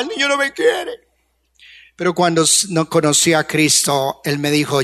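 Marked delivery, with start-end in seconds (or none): 0.0-0.9 put-on a touch of tearful child; lightly voiced crying child; mild child's crying. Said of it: "Entonces yo lloraba".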